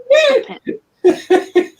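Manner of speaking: high laughing plea. Real, not acted. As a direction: say it while laughing. Laughing at the dog.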